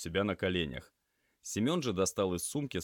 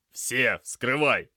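loud, higher, drawn-out order; urgent command; 6 semitones higher, 9 dB louder, much slower than usual. Acted. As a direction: press the assistant to act now. Urgent order to open dressings.

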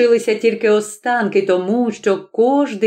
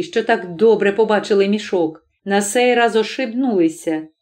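usual speaking voice

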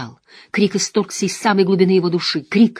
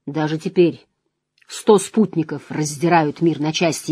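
usual speaking voice

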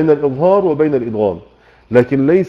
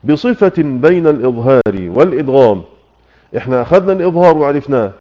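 ordinary talk, nothing marked

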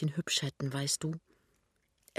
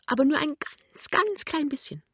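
much higher, tiny-voiced hedge; hasty qualification; 12 semitones higher, 4 dB louder than usual. A narrator's reading. She plays down her savings to him, high and hasty. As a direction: hurry to play it down in a high little voice. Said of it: "Und ganz rasch".

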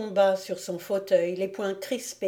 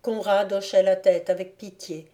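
ordinary delivery

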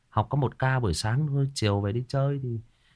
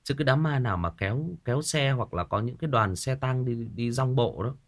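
ordinary talk, nothing marked

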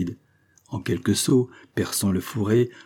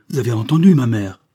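urgent question; 2 semitones higher, 8 dB louder than usual. A narrator's reading. Daughter running home to her mother after gunfire, urgent question. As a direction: ask it urgently, opening loud.

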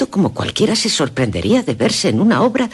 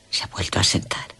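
susurro; 7 semitones lower, 7 dB quieter than usual. Acted. Narración íntima, susurrada.

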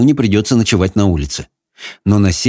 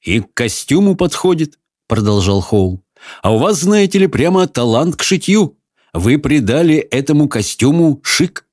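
put-on young man voice; 5 semitones higher than usual. Howl voiced by narrator.

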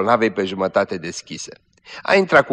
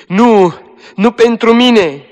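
"nu pentru mine" high, loud and slow, falling; "nu pentru mine" wailed high and slow, falling at the end; despairing wail; 13 semitones higher, 9 dB louder, slower than usual. Acted. Dying girl repeats despairing.

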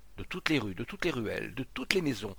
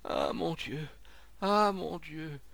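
higher, drawn-out moaned sigh; 6 semitones higher, slower than usual. A narrator's reading. Monsieur Rodiguet coming round before the crowd, sighing. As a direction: sigh it out slowly in distress. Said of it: "monsieur Rodiguet qui soupirait".

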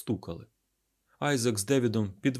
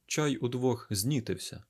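usual speaking voice

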